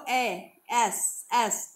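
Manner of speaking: एस drawn out very slowly, its ए start held; एस said in slow motion to show the vowel; sound demo. Shown as a demonstration. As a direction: break एस apart slowly: ए, then एस. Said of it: "एस बोल के देखो".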